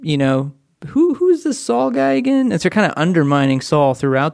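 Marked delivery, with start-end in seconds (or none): 0.9-2.5 high, sarcastic put-down; mock dismissive. The writers belittle King Saul, mock dismissive sneer. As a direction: toss off the name like he's nobody.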